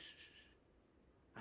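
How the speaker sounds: whispered shh to calm her; soothing shh to a crying child; soft hushing. Retold or imitated. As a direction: hush her gently.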